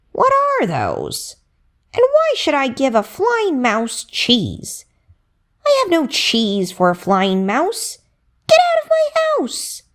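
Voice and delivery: put-on rat voice, ending in a high squeal; a stingy rat shooing off a beggar; grumpy rat voice. The rat turns the flying mouse away, squealing voice. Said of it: "looked at his wings and said".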